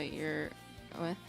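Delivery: ordinary delivery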